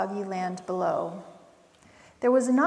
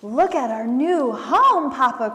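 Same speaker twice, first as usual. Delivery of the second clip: crying out; 3 semitones higher, 7 dB louder than usual. A narrator's reading. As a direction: show it off proudly to Papa, higher and louder. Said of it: "cried the little possums".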